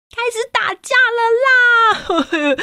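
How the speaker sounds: high, slow wailing exclaim; storyteller crying out that the kids are fighting; mock alarm. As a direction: pretend dismay. Announcing the fight.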